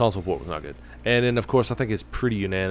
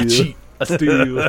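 Eddie Guerrero; 3 semitones higher, 6 dB louder than usual. Pretend. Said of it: "channel the spirit of Eddie Guerrero".

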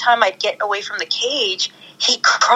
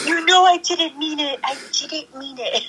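higher, fading coo; pretend pleading; 7 semitones higher than usual. Cruella actor pleading with puppies, higher coo.

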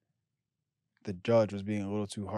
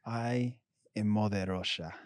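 slow, hammed-up Russian accent; playful Russian accent; mock Russian accent; slower than usual. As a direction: declare it slowly in put-on Russian. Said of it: "I'm gonna say it in a Russian".

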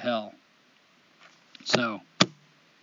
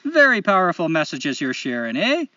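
higher, exaggerated Canadian lilt; Canadian accent; 4 semitones higher, 4 dB louder than usual. Host reading a listener's letter aloud, hammed-up Canadian accent. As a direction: put on a chipper, apologetic Canadian accent. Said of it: "in the best Canadian accent I know".

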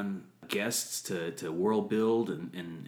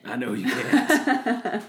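high laughing reply; 9 semitones higher, 5 dB louder, much slower than usual. Real, not acted. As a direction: laugh through the words. Laughing at partner.

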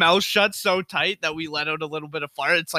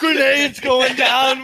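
loud, high, drawn-out war-movie yell; mock battle shout; 7 semitones higher, 6 dB louder, much slower than usual. Soldier warning the squad of grenades, mock battle shout. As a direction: yell it like a soldier under attack.

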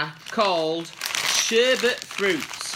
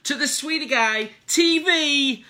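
upbeat show intro; 7 semitones higher, 5 dB louder, slower than usual. Acted. Channel intro greeting.

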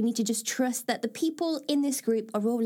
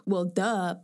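lightly mocking, offhand "well, duh"; a mild, dismissive shrug of a line; blasé; 4 semitones lower than usual. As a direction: toss it off like it's obvious. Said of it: "it sounds so blasé".

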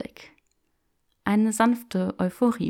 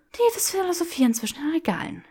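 higher mocking snort; snorting scoff; 10 semitones higher than usual. Granger mocking Draco's musing, snorting. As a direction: scoff at his philosophising. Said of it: "schnaubte Granger".

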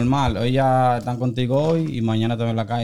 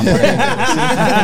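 laughing; 11 semitones higher, 6 dB louder, much slower than usual. Real, not acted. Joking about his preferences.

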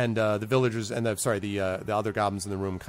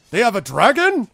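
high, loud startled question; shocked; 12 semitones higher, 10 dB louder than usual. Acted. Goblin chief hearing of dragon, loud shock. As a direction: jump up in alarm. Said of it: "He says".

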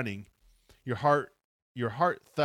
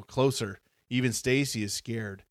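ordinary delivery, nothing marked

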